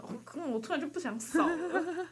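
suddenly loses the will, whiny; sulky reluctance. Retold or imitated. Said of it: "然后你就会觉得".

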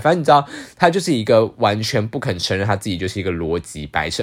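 ordinary delivery

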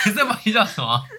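high and loud, words spilling through laughter; laughing. Real, not acted. Teasing co-host about the scare, laughing hard. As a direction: talk while cracking up.

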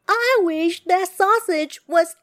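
high, loud emphatic plea; desperate wish. A narrator's reading. Wife wishing sausage off her nose, high desperate cry.

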